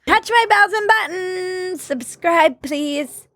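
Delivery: high, slow robot-woman voice; playful fembot. Pretend. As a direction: play a fembot reciting a sign-off. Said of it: "I'm a fembot now".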